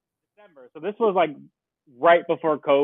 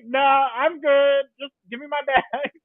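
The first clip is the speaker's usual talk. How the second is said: slow, high, airy dismissal; breezy brush-off; 9 semitones higher than usual. Retold or imitated. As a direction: wave it off, drawn out. Brushing off the wheelchair offer.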